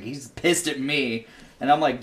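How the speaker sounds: ordinary talk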